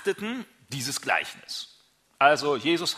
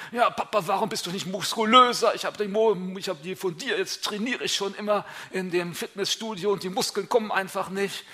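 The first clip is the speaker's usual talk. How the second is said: higher, whining reproach to dad; mock son whining about his muscles; son blaming dad; 6 semitones higher than usual. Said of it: "oder die Männer sagen".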